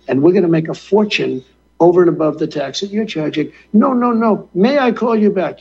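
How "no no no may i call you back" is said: high-pitched, hurried French pleading; mock French accent. French leader asking to call back, mock French accent. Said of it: "he's doing his voice impressions".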